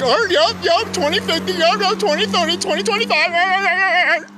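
high, loud, silly yes-man voice; mocking dimwit. Mocking those who expect a far-off date, dopey imitation. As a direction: mimic a mindless agreer, stretching the end. Mimicking people who agree blindly.

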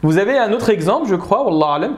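normal talking voice

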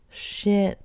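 ordinary talk

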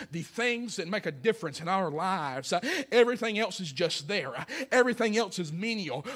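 preacher calling for an amen; fervent preaching. Real, not acted. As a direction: lean into each phrase for the congregation. Preaching climax rises again.

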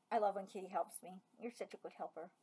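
soft, low cooing to a pet; tender praise. Real, not acted. Owner softly praising Kitty, tender and low. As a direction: coo it gently to the cat.

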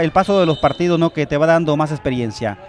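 ordinary delivery

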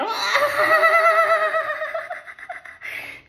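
high loud cackle; triumphant shrieking laugh. Real, not acted. Gloating over the doctor, a high shrieking laugh.

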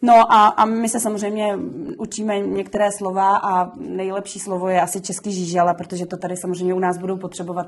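ordinary talk, nothing marked

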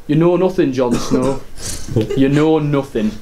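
low, mock grave movie quote; quoting Jon Snow line. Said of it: "and I'm like".

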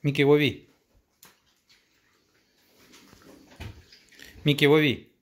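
loud call to a dog. Real, not acted. Throwing food to the dog.

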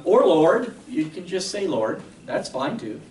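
ordinary talk, nothing marked

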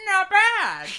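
mock approval. Player voicing the hero's thought, mock approval. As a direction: punch it up with a comic lift. Acted thought in game text.

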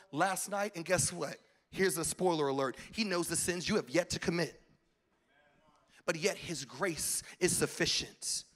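normal talking voice

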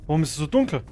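real startled yells; startled shout. Real, not acted. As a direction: blurt out amazement at the dark room. Gamer startled entering dark place.

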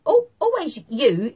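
squeaky little voice. Retold or imitated. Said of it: "in her squeaky little voice".

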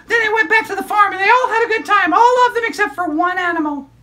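put-on high, bright child puppet storyteller; squeaky kid character excitedly telling a story; high-pitched puppet girl narrating.

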